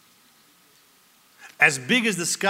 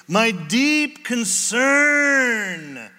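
slow, drawn-out loud cry of concern; anguished shout; 6 semitones higher, 5 dB louder, much slower than usual. Acted. Pastor voicing Paul's cry over the churches, slow anguished shout. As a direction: let the words burst out slowly with anguish.

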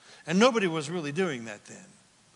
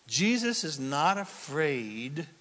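usual speaking voice

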